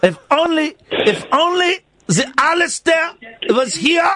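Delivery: mock-solemn Swiss accent; silly, earnest Swiss villager's accent. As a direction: proclaim in a hammed-up Swiss accent. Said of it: "It's a Swiss accent".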